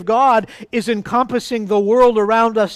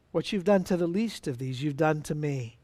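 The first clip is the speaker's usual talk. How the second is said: much softer and lower echo of the verse; hushed repeat; 5 semitones lower, 10 dB quieter than usual. Real, not acted. Preacher repeating the verse to church, hushed and soft. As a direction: repeat it near a hush, letting it sink in.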